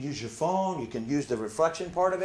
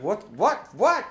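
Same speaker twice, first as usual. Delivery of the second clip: rising, louder startled what, what, what; 7 semitones higher, 6 dB louder, much slower than usual. Retold or imitated. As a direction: caught off guard and climbing higher. Startled reply to angry friend.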